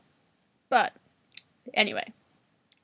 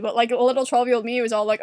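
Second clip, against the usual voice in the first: normal talking voice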